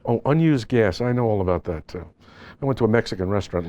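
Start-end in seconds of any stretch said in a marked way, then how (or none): none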